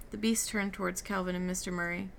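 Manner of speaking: usual speaking voice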